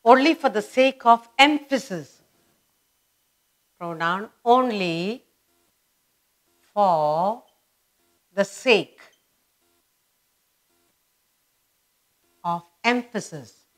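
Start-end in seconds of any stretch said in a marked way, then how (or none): 3.8-13.6 drawn-out dictation with long gaps; feed it out slowly for writing down; piecemeal dictation. Shown as a demonstration.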